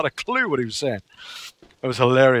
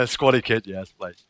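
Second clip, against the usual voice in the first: ordinary talk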